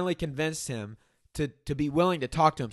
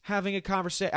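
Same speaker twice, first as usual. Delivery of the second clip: higher, pleading mock politeness; mock coddling; 5 semitones higher than usual. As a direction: parody someone tiptoeing round a fragile person. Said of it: "I didn't say that".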